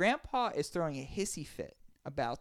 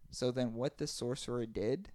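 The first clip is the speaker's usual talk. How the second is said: usual speaking voice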